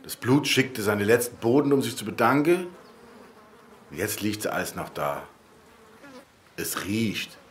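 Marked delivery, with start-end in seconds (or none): 3.9-7.4 hushed grim report. Acted.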